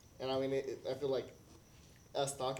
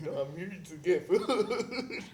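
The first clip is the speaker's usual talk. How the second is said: laughing, slow quote; 5 semitones higher, 6 dB louder, much slower than usual. Retold or imitated. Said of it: "I'm like".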